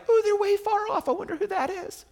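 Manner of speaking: high put-on falsetto wondering; playfully clueless high voice; mock oblivious onlooker. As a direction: squeak it out high, as a silly onlooker. Mocking a casual, distant sighting.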